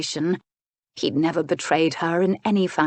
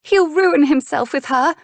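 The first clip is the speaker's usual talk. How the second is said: high, loud motherly alarm; pitched way up, dramatic dismay; distraught warning; 10 semitones higher, 6 dB louder than usual. Acted. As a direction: cry it out as an alarmed duchess. Duchess frets over son.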